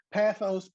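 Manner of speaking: pathos with a flat "a" as in cat, PATH-os; pathos variant. Shown as a demonstration. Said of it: "Now, again".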